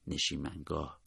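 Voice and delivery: low, very soft murmur; hushed. A narrator's reading. Feverish wounded man confides in the narrator's ear, hushed. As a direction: say it softly, right into an ear. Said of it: "آهسته گفت".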